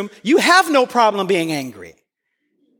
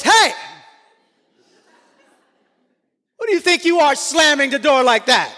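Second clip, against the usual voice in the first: angry parent; 3 semitones higher than usual. Retold or imitated. Parent scolding the child for slamming the door, mock angry yell.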